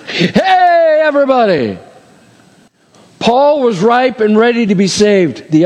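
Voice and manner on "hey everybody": much higher, calling out to a crowd; joking blast to everyone at once; mock megaphone call. Preacher joking God should have called out to all. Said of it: "megaphone it".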